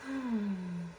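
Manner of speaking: long slow breath out; deep calming exhale. Real, not acted. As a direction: let out a big breath. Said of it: "let's just do that again real quick".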